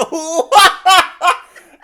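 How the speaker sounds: loud, high burst of laughter; helpless cackling; big laugh. Real, not acted. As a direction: crack up uncontrollably. Cracking up at the impression.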